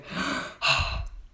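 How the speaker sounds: soft, voiceless nervous gasp; breathy gasp. Real, not acted. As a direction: let out a hushed gasp. Shaking with nerves before hatching.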